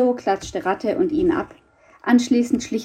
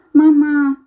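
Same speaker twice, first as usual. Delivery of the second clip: high, loud call for mother; 7 semitones higher, 8 dB louder than usual. Acted. Little boy wakes at night and calls his mother, calling out. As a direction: call out from bed for Mama. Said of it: "rief er jetzt lauter".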